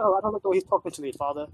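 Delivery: lightly voiced spoiled brat; whiny kid. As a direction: tattle like a spoiled child. Kid whines to his father.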